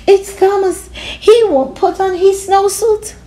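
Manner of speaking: high, complaining teacher; whiny teacher voice. Acted. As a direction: tattle to the boss.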